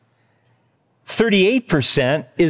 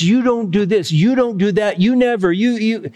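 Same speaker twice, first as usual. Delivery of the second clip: high-pitched spouse accusations; mock bickering, pitched up; accusatory arguing; 6 semitones higher than usual. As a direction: argue back with blame on each "you". Acted marital argument, accusing.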